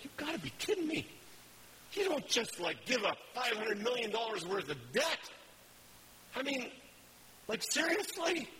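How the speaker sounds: you can't believe what you just saw; shocked managers can't believe the CEO forgave the debt, pitched high; incredulous. Retold or imitated. Managers react in disbelief.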